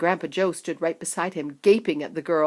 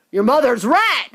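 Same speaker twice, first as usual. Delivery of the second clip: shouting; 5 semitones higher, 8 dB louder than usual. A narrator's reading. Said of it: "shouted Mister Beauregard".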